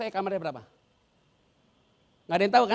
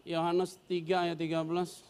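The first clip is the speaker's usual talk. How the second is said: slow, drawn-out reference; carefully spelled-out Bible reference; slow, careful announcement; 3 semitones lower, 4 dB quieter, slower than usual. Shown as a demonstration. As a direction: read it out slowly and clearly. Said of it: "sorry, sorry".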